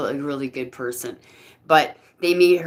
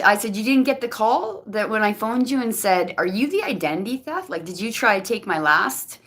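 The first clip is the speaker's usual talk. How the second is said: ordinary talk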